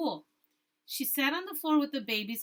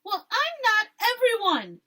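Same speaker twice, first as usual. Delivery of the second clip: higher, louder, slow defiant yell; 10 semitones higher, 8 dB louder than usual. Acted. Esperanza yelling back at Isabel, higher and louder. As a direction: snap it back at her, slow and loud. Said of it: "yelled Esperanza".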